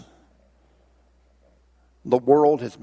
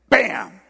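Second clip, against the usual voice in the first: loud, higher exclaimed bam; sudden impact noise; 5 semitones higher, 5 dB louder than usual. Acted. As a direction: punch it out like a hit. Imitates slingshot stone hitting goose.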